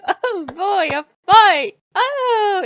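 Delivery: loud, high thrilled cheer; loud delighted whoop; gleeful excitement. Retold or imitated. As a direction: cheer like the eager war god. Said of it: "Odin's like".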